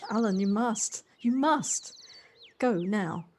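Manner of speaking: loud, rising final command; emphatic loud insistence ending in a sharp order; forceful urging. Acted. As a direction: drive him out the door with your voice. Urging Alan to go home.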